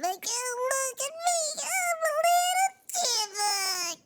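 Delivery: high, squeaky little chipmunk. Retold or imitated. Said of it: "try to start talking like the voice".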